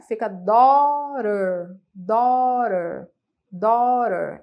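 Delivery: "daughter" drawn out slowly, the GH silent and the T flapped like an R, doɾer; slow model pronunciation. Shown as a demonstration. Teacher slowly modeling "daughter" for the class, T flapped like R. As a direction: slowly spell out "daughter" by sound, T as a quick R. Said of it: "Esse T tem um som de R".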